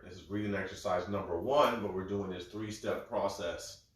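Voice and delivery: ordinary talk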